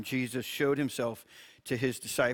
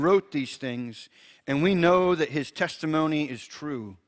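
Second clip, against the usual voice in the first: scripture reading; 3 semitones higher, 5 dB louder than usual. Acted. Pastor reads John to listeners, a reverent scripture reading.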